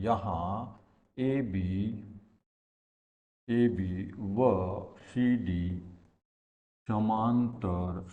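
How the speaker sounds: very slow, drawn-out syllables with long gaps; slow dictation. Real, not acted. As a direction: say each word as you write it out. Dictating slowly while writing on board.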